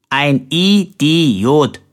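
"Idiot" broken slowly into stressed syllables; syllable-by-syllable emphasis. A narrator's reading. Stranger correcting Colbert, "Idiot" slowly stressed on every syllable. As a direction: stretch "Idiot" out, punching each syllable. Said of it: "betonte dabei jede einzelne Silbe".

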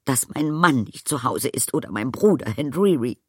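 high, upset warning; indignant threat. A narrator's reading. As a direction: threaten him, still worked up. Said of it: "fuhr sie fort".

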